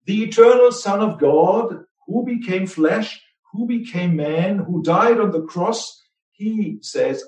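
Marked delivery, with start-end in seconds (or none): none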